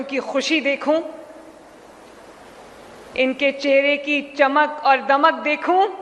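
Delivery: measured oratory with long pauses between phrases; formal public speech. Real, not acted. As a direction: address the hall phrase by phrase, letting each one land. Formal address to assembled audience. (ٹھہر ٹھہر کر رسمی عوامی خطاب)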